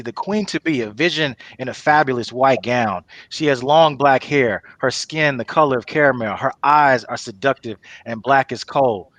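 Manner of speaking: normal talking voice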